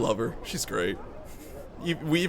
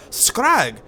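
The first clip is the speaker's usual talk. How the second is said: Scrag? asked high in an Irish lilt; Irish voice, puzzled and higher on Scrag?; Scrag? with rising Irish disbelief; Irish accent; 8 semitones higher, 4 dB louder than usual. Acted. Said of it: "I gotta get Irish".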